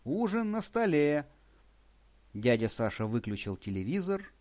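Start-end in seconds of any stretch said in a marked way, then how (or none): 0.0-1.2 booming call. A narrator's reading. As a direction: call out across the house. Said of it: "зычным выкриком".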